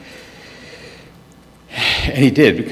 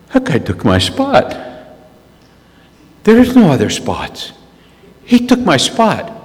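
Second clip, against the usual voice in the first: indignant outrage; 7 semitones higher, 3 dB louder than usual. Retold or imitated. Fisherman fuming that another angler took his spot, indignant. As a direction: sputter that someone stole your spot.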